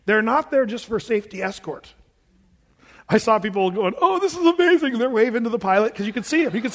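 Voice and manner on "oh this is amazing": high, loud gushing like thrilled passengers; mock amazement. Excited passengers admiring the fighter jets, mock amazement. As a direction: gush in imitation of delighted tourists.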